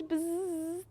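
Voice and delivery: high, soft buzz; brief bumblebee imitation; bee buzz. Shown as a demonstration. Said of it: "my animal sounds".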